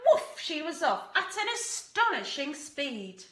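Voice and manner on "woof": high, loud woof; bark. Acted. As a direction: bark it out like an eager dog. Dog bark in story.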